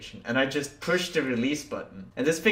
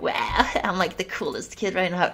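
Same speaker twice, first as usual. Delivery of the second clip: put-on excited kid; 8 semitones higher than usual. Retold kid bragging.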